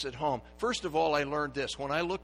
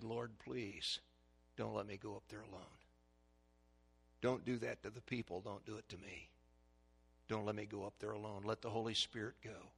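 quiet prayer; 4 semitones lower, 11 dB quieter than usual. Retold or imitated.

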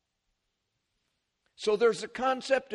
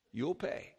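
put-on Boston accent; 6 semitones lower, 7 dB quieter than usual. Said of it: "I can't say it exactly like they do".

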